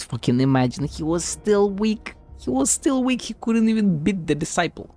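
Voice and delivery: normal talking voice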